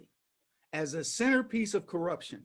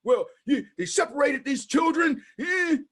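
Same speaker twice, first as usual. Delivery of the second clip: higher, louder flustered mock Biden; 6 semitones higher, 4 dB louder than usual. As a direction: sputter back defensively. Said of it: "Biden's over there".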